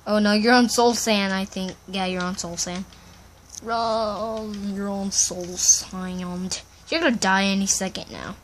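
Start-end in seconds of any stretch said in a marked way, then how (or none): 3.6-6.6 low, stretched-out words; slow-motion warning. Pretend. Boy warning friend on Soul Sand, slow drawn-out voice. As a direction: drag it out in mock slow motion.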